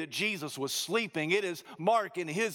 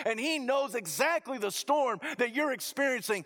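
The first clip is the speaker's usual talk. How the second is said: preaching at full force; 6 semitones higher than usual. Acted. Pastor assuring the congregation that Jesus cares, fervent. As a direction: build it up like a revival preacher.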